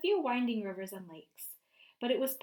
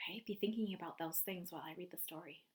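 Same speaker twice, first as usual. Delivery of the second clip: whispering; 4 semitones lower, 9 dB quieter, faster than usual. Real, not acted. Teacher aside before reading.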